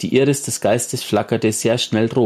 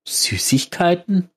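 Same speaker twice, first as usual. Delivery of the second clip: high, slow puzzled question; a touch of disbelief, drawn out; baffled; 8 semitones higher, slower than usual. Acted. Baffled by strange diagnosis. (verblüfft, hoch und langsam gefragt)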